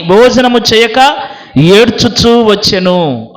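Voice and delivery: ordinary talk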